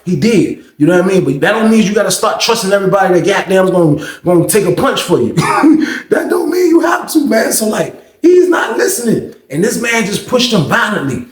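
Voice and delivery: ordinary delivery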